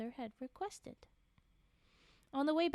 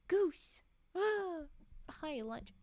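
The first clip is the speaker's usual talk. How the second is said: gasping excited; 5 semitones higher, much slower than usual. Real, not acted. Gasping playful reaction.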